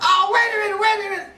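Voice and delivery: high, sudden alarmed plea; startled cry. Retold or imitated. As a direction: yelp it out as something goes wrong. Houston startled by injury.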